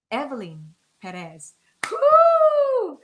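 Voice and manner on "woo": long high "woo"; loud, high-pitched cheer; excited whoop. Real, not acted. Daughter cheering as her mother joins the show, excited whoop.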